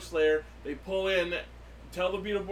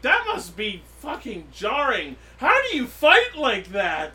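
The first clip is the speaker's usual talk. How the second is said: drawn-out, higher-pitched disbelief; incredulous reaction; 5 semitones higher, 7 dB louder than usual. Retold or imitated. Podcaster recalling own reaction to a jarring scene, incredulous. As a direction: stretch it out, louder by the end, baffled. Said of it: "And, and I'm like".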